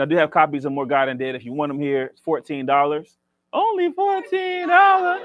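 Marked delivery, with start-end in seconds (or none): 3.5-5.2 mock sales pitch. Joking sales pitch for book.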